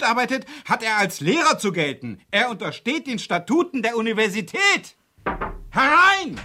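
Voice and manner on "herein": loud high call of come in. Acted. Professor calling a visitor in loudly. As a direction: call through the closed door.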